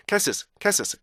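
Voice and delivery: 카스오스 rushed, with its ending rhythm dropped; "카스오스" short and even, no held ending; clipped wrong castles. Shown as a demonstration. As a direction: mimic learners squashing the tail of "카스오스". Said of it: "뒤가 리듬이 다 떨어져 나간".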